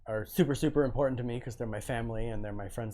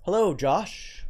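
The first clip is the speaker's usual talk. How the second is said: sing-song greeting; 6 semitones higher, 7 dB louder, much slower than usual. Acted. Greeting viewer in chat.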